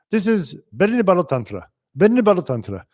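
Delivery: put-on quoting his teacher Swamiji in a gentle Indian accent. Recalling Swamiji's words to students, light Indian accent. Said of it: "With his Indian accent".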